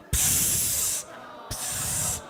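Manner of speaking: voiceless "psst" bursts; short hissed squirts of liquid; imitated spurting hiss. Imitates the first spurts of pee.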